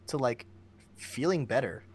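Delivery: ordinary delivery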